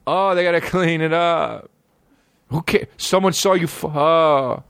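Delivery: high-pitched mock gleeful voice; mocking imitation. Comic mimics prankster kids' reasoning, high mocking voice.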